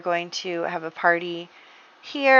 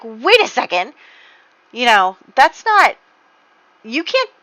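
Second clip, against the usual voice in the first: flustered protest; 6 semitones higher, 6 dB louder than usual. Acted. Francesca protests surprise proposal.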